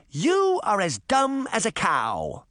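slow, high-pitched insult; indignant cry. A narrator's reading. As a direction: cry out in indignation, stretching each word. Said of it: "Moth cried".

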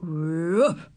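drawn-out doggy woof; dog's woof. A narrator's reading. The dog answering hidden Ramona, barking. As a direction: bark back like a dog. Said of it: "said Ribsy".

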